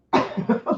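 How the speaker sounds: soft, breathy chuckle; little laugh mid-sentence; quick laugh. Real, not acted. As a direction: let out a short voiceless laugh. Laughs while asking the question.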